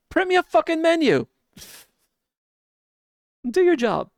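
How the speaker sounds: high, loud angry outburst; fed up, yell it out; angry shouting. Real, not acted. Angry rant about QR menus.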